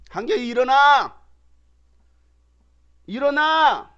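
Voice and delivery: put-on mother calling a sleepy child; rising exasperated nag; nagging mom. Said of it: "오늘도 몇 번 말했는지 몰라요".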